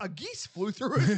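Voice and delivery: high, loud laughing repeat of geese; laughing disbelief. Retold or imitated. Boss seeing the smashed windshield, laughing. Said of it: "I'm like".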